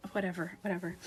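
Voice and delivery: soft, low murmur of giving up; resigned. Retold or imitated. Meditator giving up after irritation, resigned and soft. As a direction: let it go quietly, under your voice.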